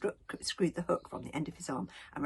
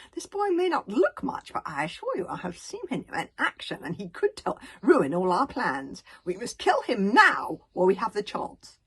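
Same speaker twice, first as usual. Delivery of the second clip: urgent insisting; 3 semitones higher, 10 dB louder than usual. A narrator's reading. Alvin urges consul.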